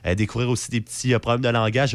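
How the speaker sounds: normal talking voice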